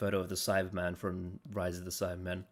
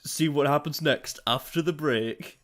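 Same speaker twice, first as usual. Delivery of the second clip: game show host; 5 semitones higher, 5 dB louder than usual. Retold or imitated. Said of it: "sounds like a game show host".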